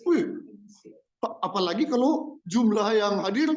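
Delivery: normal talking voice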